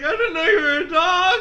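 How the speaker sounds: very high, loud laughing quip; mock nervous joke. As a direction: joke to cover the nerves. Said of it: "I was like".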